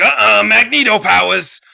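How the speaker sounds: high, loud smug proclamation; triumphant villain. Acted. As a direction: announce a superpower with a flourish. Villain reveals powers when attacked.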